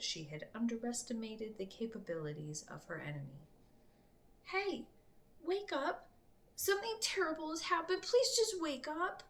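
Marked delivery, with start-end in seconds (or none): 4.4-9.2 put-on high, urgent child's voice pleading; a scared boy shaking her awake; frightened child. A narrator's reading. Panicked boy begging the priestess to wake up, high and urgent.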